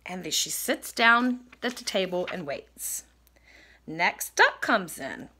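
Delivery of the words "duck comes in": ordinary delivery, nothing marked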